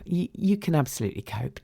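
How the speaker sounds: ordinary talk, nothing marked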